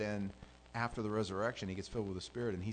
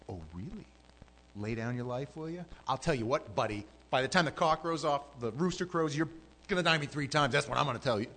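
louder, scornful put-down; mock him as a cocky boaster, louder; sarcastic Jesus; 4 semitones higher, 6 dB louder than usual. Shown as a demonstration. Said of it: "I, I don't see that".

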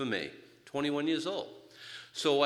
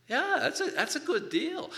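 excited delight; 14 semitones higher than usual. Retold or imitated. Eager convert's delight.

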